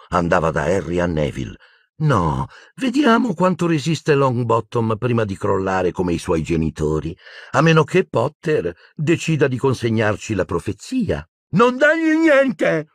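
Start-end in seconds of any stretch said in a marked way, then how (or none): 2.0-11.3 higher, excited taunting; high-voiced malicious glee; Bellatrix. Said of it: "Sembrava invasata, fremente di eccitazione". 11.5-12.9 high, drawn-out frantic yell; wild desperate scream. Acted. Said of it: "urlò Neville".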